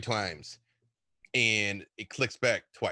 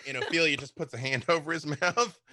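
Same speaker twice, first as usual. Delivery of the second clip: ordinary talk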